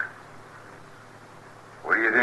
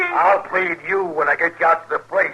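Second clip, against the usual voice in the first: angry threat; 7 semitones higher than usual. Acted. Husband threatens wife.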